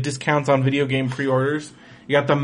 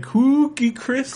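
cartoon voice; 11 semitones higher, slower than usual. Mocking the ad voice.

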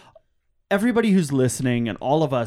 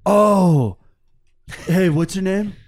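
pushy climber; 5 semitones higher, 6 dB louder, slower than usual. Pretend. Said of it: "I'm gonna spray you down".

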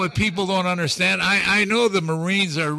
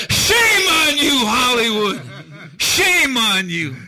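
loud, higher, slow scolding with a laugh in it; indignant shout; 7 semitones higher, 8 dB louder, slower than usual. Real, not acted. Guest scolding Hollywood over its movies, slow loud indignant shout. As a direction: call Hollywood out, drawing each word.

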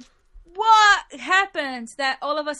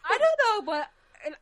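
laughing; 10 semitones higher, faster than usual. Real, not acted. Laughing about their picks.

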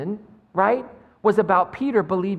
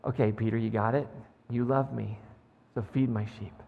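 gentle reassurance; 7 semitones lower, 6 dB quieter than usual. Acted. Jesus reassures Peter.

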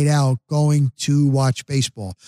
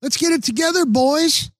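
mock fan yelling, pitched way up; high cheering yell; 12 semitones higher than usual. Fan at a hockey game yelling at players, high mock cheer. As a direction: cheer on the team like a shrill fan. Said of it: "she just was yelling".

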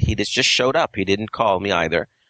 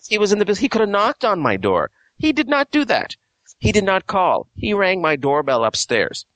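indignant outrage; 10 semitones higher than usual. Real, not acted. Outraged complaint about landlord.

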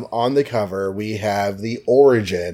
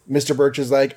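usual speaking voice

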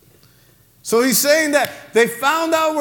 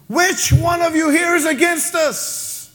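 a mildly exasperated king, voice a little raised; frustrated king; 6 semitones higher than usual. Acted. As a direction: demand it like a ruler who is fed up. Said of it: "the king gets so frustrated".

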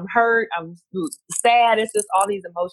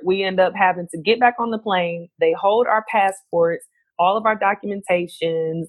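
ordinary talk, nothing marked

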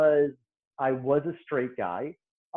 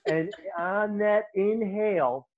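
usual speaking voice

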